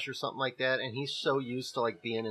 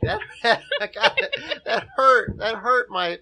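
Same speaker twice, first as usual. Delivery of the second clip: laughing; 7 semitones higher, 8 dB louder, slower than usual. Real, not acted. Laughing after speed-reading.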